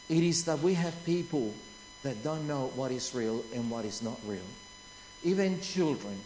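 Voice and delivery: low, soft sermon voice; solemn preaching. Real, not acted. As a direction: bring it down to a grave, quiet register. Preaches in a hushed register.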